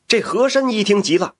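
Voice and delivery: ordinary delivery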